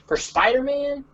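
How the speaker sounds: plain reading voice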